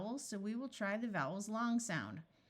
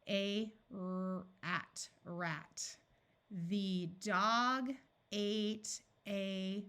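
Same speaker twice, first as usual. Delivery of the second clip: slow reading; much slower than usual. Shown as a demonstration. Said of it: "reading slowly, sentence practice".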